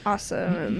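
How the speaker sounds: usual speaking voice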